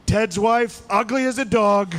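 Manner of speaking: high, out-of-nowhere insult impression; Donald Trump. Candidate interrupting an economy debate with an insult, high impression. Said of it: "he's just like".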